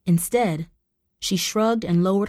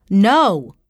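a sudden loud blurted no; forceful no; 8 dB louder than usual. Acted. Tara refusing her mother, sudden loud forceful no. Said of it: "surprised at how forceful her voice was".

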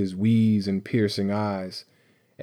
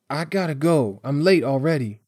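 put-on higher-pitched voice of an uneasy boy; anxious boy; 5 semitones higher than usual. Boy trying to get away from Bones, anxious. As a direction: pitch it up, making a nervous excuse. Nervous boy making excuses to leave.

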